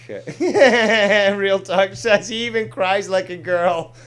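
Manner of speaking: loud, high laughter through words; laughing hard. Real, not acted. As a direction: burst out laughing.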